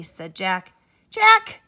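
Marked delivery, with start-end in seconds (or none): 1.1-1.6 loud, high call of his name; exasperated call. Acted. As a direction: snap his name out in disbelief. Bunny exclaims at Jack.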